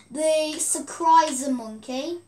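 ordinary talk

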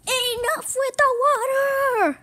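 high, loud, slow pained yell; pained shout. Acted. A character with sore ears yelling at the water, pained shout.

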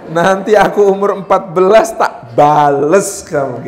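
slow, loud, drawn-out threat; hammed-up revenge vow, words stretched; mock vengeful vow. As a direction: play a child plotting payback. Child plotting revenge, acted.

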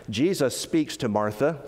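ordinary delivery

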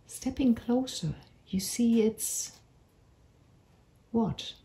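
unhurried meditation voice with long pauses; measured storytelling for a calming visualisation; guided meditation. Acted. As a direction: guide them gently through an imagined walk, phrase by phrase. Leads a guided imagery meditation.

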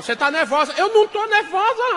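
very high, loud denial; angry quarrel. Acted. Wife yelling at husband in quarrel, high loud. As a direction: scream you're not nervous.

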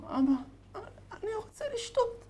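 high, faint, frail voice from a sickbed; weak whisper of sick son. Acted. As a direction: barely breathe it out like a bedridden boy. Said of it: "הבן לוחש לו".

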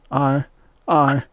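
high, halting and scared; nervous stammer. A narrator's reading. Rye asked to do something for Extroni, nervous stammer. Hunter stammering at a frightening request.

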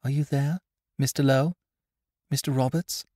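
lightly voiced young woman calling softly; soft girl's voice. A narrator's reading.

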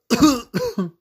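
real coughing fit from laughing; high, hacking laugh-cough; coughing from laughter. Real, not acted. Player laughed too hard at the game, coughing fit. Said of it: "I'm coughing 'cause I'm laughing too hard".

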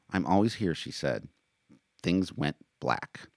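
lively read-aloud of a novel; story narration. A narrator's reading. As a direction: narrate the chapter with voiced characters. Reads his novel aloud.

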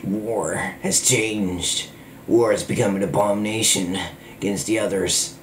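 gruff war narrator. Retold or imitated. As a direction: intone it like a brooding war-game hero. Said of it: "I can actually do a lot of voices".

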